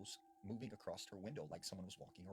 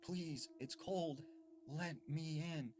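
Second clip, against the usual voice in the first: put-on high, drawn-out boy's plea; eerie child pleading; 6 semitones higher, much slower than usual. Strange boy at the front door asks to come inside, eerie pleading.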